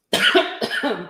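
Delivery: real throaty coughing fit; choking cough. Real, not acted. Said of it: "Gosh, I'm choking".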